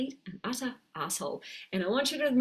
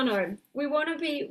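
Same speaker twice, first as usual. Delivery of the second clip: ordinary talk, nothing marked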